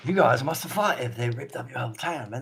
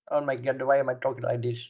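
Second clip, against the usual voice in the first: usual speaking voice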